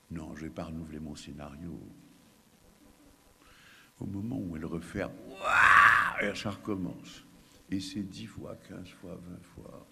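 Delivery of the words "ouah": put-on a loud breathy baby scream; loud unvoiced infant cry; baby's wail again, breathy. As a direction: wail the moment the door closes.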